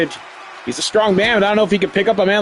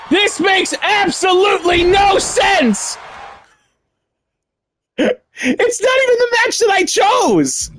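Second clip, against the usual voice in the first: outraged shout; 10 semitones higher than usual. Real, not acted. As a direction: shout in disbelief, much higher. Wrong wrestler appeared in match.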